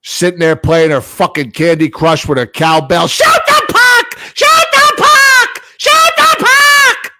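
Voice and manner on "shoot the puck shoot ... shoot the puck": high shrieking mock yell; shrill high-pitched mock scream; shrill screaming fan. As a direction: screech it like an annoying fan. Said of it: "she's got this shrill voice".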